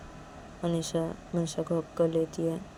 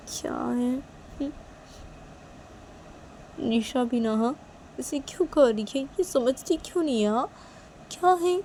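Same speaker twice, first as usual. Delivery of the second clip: higher, petulant muttering to herself; sulky grumbling; 6 semitones higher than usual. Acted. Girl grumbling alone about her friend, petulant sulk. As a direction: complain under your breath, put out.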